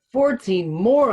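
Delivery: a stunned, dismayed exclamation; dismayed exclaim. Acted. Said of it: "I exclaim".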